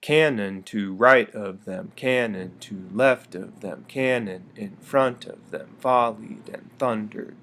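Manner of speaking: slow, DUM-da-da stress on each line; dactylic reading. Shown as a demonstration. Teacher reads poem to show dactylic rhythm. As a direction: lean hard on each first beat. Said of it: "I'm gonna read it".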